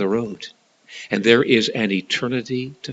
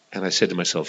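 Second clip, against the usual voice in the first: normal talking voice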